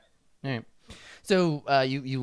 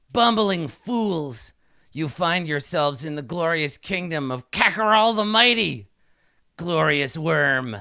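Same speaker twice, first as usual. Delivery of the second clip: higher, louder, slow grand proclamation; imperious kobold king; 4 semitones higher, 6 dB louder, slower than usual. Acted. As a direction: belittle them like a pompous monarch. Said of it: "He says, uh".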